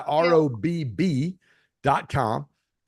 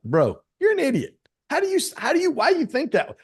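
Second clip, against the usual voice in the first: higher, mock-baffled jab at a friend; half-laughing fake outrage, pitched up; mock exasperation; 12 semitones higher than usual. Retelling a jab at his friend, higher and mock-exasperated. Retold argument, "I'm like.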